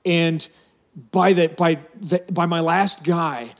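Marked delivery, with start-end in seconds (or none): none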